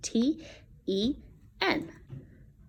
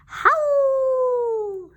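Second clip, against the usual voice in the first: high, louder howl; wolf howl; 4 dB louder than usual. Shown as a demonstration. Teacher showing the class a howl, howling. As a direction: give them your best howl. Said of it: "Let's hear your best howl".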